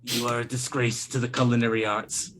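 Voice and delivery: a hint of a disdainful godfather; the Don. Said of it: "the Don just looks and just goes".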